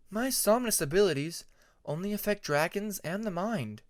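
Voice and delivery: put-on gentle dragon girl explaining her magic; Tempest's voice. A narrator's reading.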